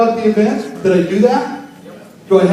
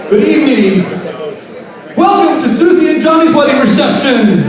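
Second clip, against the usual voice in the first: louder, higher emcee welcome; MC announcement; 4 semitones higher, 5 dB louder than usual. Shown as a demonstration. DJ opening the wedding reception, booming. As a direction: announce the couple to the room. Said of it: "Give me a natural reaction".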